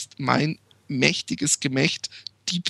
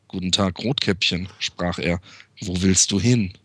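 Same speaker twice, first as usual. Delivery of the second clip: wolf voice; 7 semitones lower than usual. Acted. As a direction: growl it like the wolf in a bedtime story. Wolf voiced in a fairy tale.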